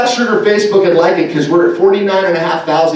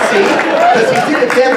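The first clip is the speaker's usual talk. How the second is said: ordinary talk, nothing marked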